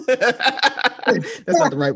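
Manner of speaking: high, slow words broken by laughter; laughing so hard the words stretch. Real, not acted. Admitting the wrong word to co-host, laughing hard.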